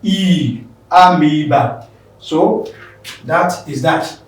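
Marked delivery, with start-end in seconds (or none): 0.0-1.9 long E in amoeba, said "a-MEE-ba"; "amoeba" with EE, never "oe"; long E pronunciation. Shown as a demonstration. Biology word corrected for exam students, amoeba with a long EE. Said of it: "it's not oe, but rather it is what? E".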